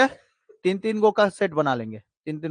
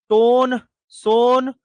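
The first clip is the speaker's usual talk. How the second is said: drawn out; 2 semitones higher, much slower than usual. Shown as a demonstration. Teacher drilling students on river names, slow drawn-out chant. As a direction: stretch टोन and सोन out slowly, each word long. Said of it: "teacher drills river mnemonic".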